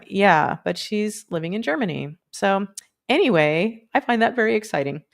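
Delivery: ordinary delivery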